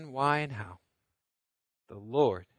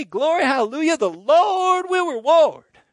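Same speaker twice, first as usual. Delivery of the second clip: loud, much higher, slow hallelujah cry; glory shout; 11 semitones higher, 13 dB louder than usual. Shown as a demonstration. Pastor rouses the room to stand and shout praise. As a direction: whoop it up like an old school revival. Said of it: "shake your hands and say".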